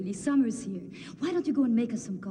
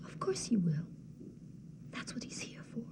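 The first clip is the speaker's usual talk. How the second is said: resigned whisper; 3 semitones lower, 10 dB quieter than usual. Acted. Antigone telling Ismene Creon will kill them, whispered. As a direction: say it hushed and resigned. Accepting death sentence calmly.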